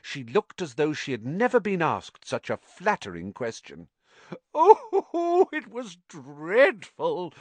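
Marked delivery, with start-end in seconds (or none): 4.5-7.3 put-on high, slow, relishing ghost girl. Ghost girl relishes telling Harry how she died. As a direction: savour every word of the tragedy. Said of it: "she said with relish".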